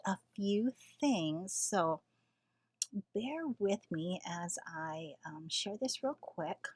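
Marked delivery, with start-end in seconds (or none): 3.1-6.7 hushed, softer and slower; half-whispering. Real, not acted. Asking late-night viewers to bear with her, half-whispered. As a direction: keep your voice down, nearly whispering. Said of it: "Why am I whispering?".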